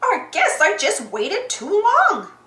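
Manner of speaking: drawn-out character lament; Clarabelle character voice. A narrator's reading. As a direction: draw it out as Clarabelle. Clarabelle voiced in read story.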